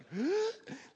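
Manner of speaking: high shocked gasp, imitating his wife; a sharp imitated gasp of hurt surprise; mock gasp. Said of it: "So Kathy was like".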